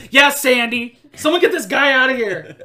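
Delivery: loud, high comic outburst; loud interruption. Retold or imitated. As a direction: burst in yelling to throw him out. Randall Park bursts in.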